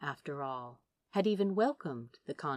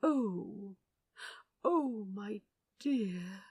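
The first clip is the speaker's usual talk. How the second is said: tender realization; 3 semitones higher, much slower than usual. A narrator's reading. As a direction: let the understanding land gently. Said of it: "grew wide with understanding".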